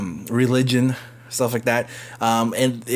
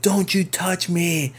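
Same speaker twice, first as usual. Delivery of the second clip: slow, higher mock-angry warning; imitating an old woman snapping a threat, slowly; angry old lady; 6 semitones higher, slower than usual.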